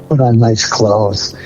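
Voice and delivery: ordinary talk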